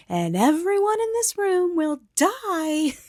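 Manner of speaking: high, loud, drawn-out menacing yell; a big theatrical high yell; yelled threat. Retold or imitated. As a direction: yell it at the whole party, slowly. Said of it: "turns to the party and yells".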